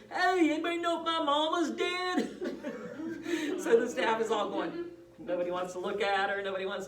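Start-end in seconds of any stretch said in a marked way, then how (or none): 0.1-3.6 loud, high, drawn-out calling out; imitated holler. Miss Becker asking the staff at dinner, imitated loud holler. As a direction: holler it slowly to the whole room, imitating a resident. Resident hollers across the dining room.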